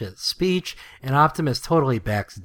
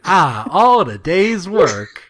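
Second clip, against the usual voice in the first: slow, louder, self-satisfied with a laugh; smug Prowl, higher and laughing through it; 5 semitones higher, 8 dB louder, slower than usual. Retold or imitated. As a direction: savour the win, pleased with yourself. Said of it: "He's so smug".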